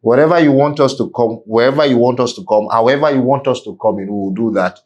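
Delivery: usual speaking voice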